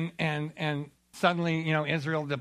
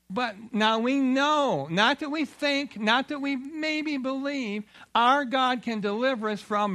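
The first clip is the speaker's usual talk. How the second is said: higher, slow, emphatic faith; firm declaration; 7 semitones higher, slower than usual. Acted. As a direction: declare it slowly, word by word. Youths declare their faith.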